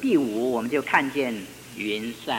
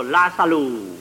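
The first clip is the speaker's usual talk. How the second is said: loud call; 7 dB louder, slower than usual. Retold or imitated. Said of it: "他就大声地说".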